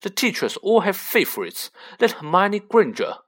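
lightly acted petulant grievance; retort. A narrator's reading. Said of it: "retorted Draco".

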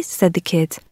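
plain reading voice

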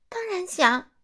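high, bright eager answer; eager boy. A narrator's reading. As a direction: jump at the offer. Simon eager for story. (西蒙迫不及待地回答)

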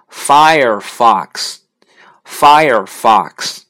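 slow, careful Firefox repeated twice; careful English name. Shown as a demonstration. Teacher modelling Firefox slowly twice. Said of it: "火狐呢，就是".

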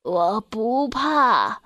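put-on very slow old elephant voice, words drawn out; slow, drawn-out elder's reply; old elephant character. A narrator's reading. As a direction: answer as an aged elephant, slowly and steadily. Old elephant answers the mouse.

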